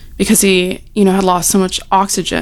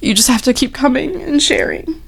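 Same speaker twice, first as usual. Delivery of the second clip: on the verge of tears; 4 semitones higher than usual. Real, not acted. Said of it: "I'm gonna cry".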